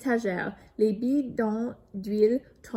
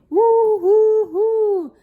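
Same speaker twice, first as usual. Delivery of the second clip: much higher, much louder howls; howling; 12 semitones higher, 13 dB louder, much slower than usual. A narrator's reading. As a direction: yelp each bounce across the garage. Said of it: "hurle Eric en rebondissant".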